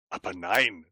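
put-on young man's reply. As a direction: drop the voice for the visitor's calm answer.

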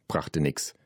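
plain reading voice

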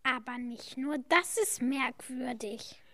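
hushed, breathy whisper. Acted. Kid whispering to friends in the spooky hut, hushed whisper. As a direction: whisper it so nobody else hears. Eerie old hut, strange discovery.

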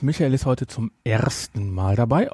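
usual speaking voice

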